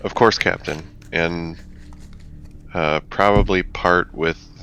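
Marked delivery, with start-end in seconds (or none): none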